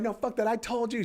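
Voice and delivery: high, defiant retold outburst; retold yelling. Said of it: "She starts yelling at him".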